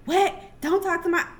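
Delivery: high, loud outraged cry; furious protective mom. Retold or imitated.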